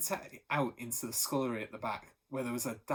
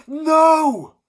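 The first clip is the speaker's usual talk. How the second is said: much louder and higher cry; desperate shout; 20 dB louder than usual. A narrator's reading. Thunderbolt crying out as police arrest Pa, desperate shout. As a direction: scream it to stop them.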